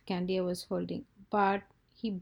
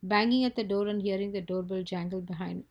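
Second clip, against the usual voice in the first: usual speaking voice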